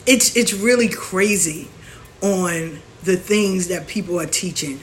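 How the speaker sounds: usual speaking voice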